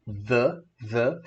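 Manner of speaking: "The" said thuh, open vowel. Shown as a demonstration. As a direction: repeat "the" as thuh. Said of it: "puxa mais pro som do O".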